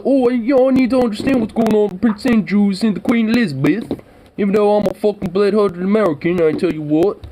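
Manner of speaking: high, hammed-up voice of a royal-fan American; mock royal-obsessed American. Mocking American fans of the royals in a high, hammed-up voice.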